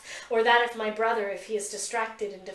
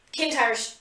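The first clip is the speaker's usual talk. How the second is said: usual speaking voice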